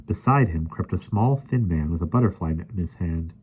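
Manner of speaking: usual speaking voice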